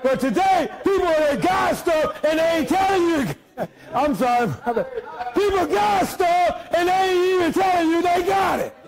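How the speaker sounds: shouted sermon climax; hollering preacher build; preaching at full force. Acted. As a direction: whip up the church.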